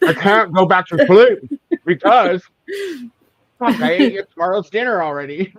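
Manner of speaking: high, loud, hammed-up confession; mock wail. Midnight snacker confesses to eating tomorrow's dinner, mock wailing. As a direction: wail it with comic despair.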